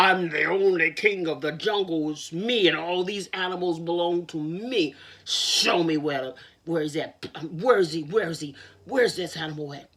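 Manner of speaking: loud, low angry lion; furious lion. Acted. Furious lion demands to see the rival, loud and low. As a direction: bellow like a furious king lion.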